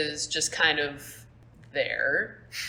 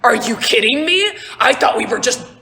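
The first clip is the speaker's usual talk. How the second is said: loud, high exasperated scolding; incredulous complaint; 6 semitones higher, 8 dB louder than usual. Acted. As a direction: berate him in disbelief. Davin scolding Joe.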